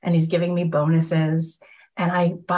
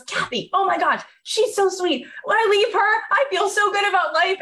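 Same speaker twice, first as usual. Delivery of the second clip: gushing praise; 11 semitones higher than usual. Retold or imitated. [name] mimics people gushing over Kathy.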